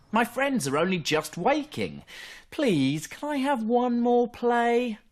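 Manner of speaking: high, slow begging cub voice; little fox pleading. Acted.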